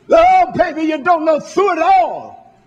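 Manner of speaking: high, loud, fired-up church mother; animated imitation of a testifying mama; wild church mama. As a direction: holler it like a mama getting carried away, slowing on the refrain. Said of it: "would just get wild and crazy".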